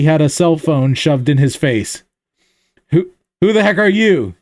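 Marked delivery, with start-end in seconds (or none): 2.9-4.3 demanding. Acted. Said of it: "he demanded".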